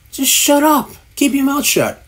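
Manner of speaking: high, sharp telling-off; exasperated order. Real, not acted. Ranting at podcast hosts who hum, exasperated high order.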